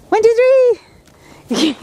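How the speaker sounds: high, loud build-up to a jump; excited countdown. Real, not acted. Counting the dog into a jump, excited. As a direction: count up eagerly, pitch leaping.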